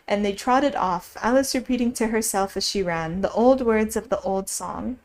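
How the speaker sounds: plain reading voice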